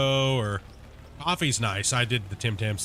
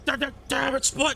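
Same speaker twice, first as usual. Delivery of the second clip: much higher, exasperated cry; a hungry cook snapping in a higher voice; frustrated kitchen outburst; 13 semitones higher than usual. Pretend. Hungry viewer slamming cupboards, yelling in a higher frustrated voice. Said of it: "start slamming cupboards".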